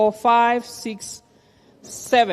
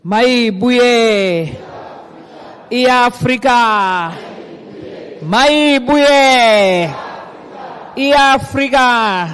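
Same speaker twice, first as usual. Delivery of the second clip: booming ideological slogans; 3 semitones higher, much slower than usual. Real, not acted. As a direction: chant it to the hall like a rally leader. Call and response slogans.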